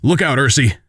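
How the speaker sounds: a contained, urgent shout of warning; yelled warning. Acted. Father warns daughter of danger, contained warning yell. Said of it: "Her father yells out".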